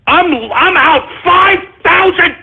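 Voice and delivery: slow, high-pitched scream of outrage; enraged wailing. Real, not acted. Host furious about lost five thousand dollars, drawn-out screaming. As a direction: drag the words out into a scream. Enraged over losing money.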